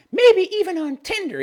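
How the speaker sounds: high airheaded woman voice; dopey female voice. Retold or imitated. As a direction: read it like a ditzy woman. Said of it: "affect my dopey female voice".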